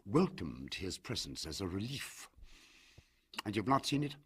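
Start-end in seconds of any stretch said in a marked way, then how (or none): 3.5-4.2 a sudden, slightly higher question; sharp, sudden question; Usher, abrupt. A narrator's reading. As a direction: blurt it out abruptly. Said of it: "he said abruptly".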